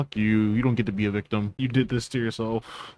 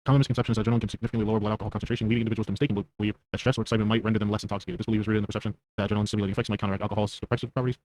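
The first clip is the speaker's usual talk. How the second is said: rapid, flat read-out; fast reading; much faster than usual. Real, not acted. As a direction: rattle it off quickly. Reads search result aloud fast.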